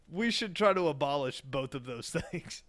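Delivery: ordinary delivery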